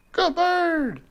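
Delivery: very high, bright praise. Real, not acted. Owner praising the parrot for whistling, very high praise. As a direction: praise the bird lavishly.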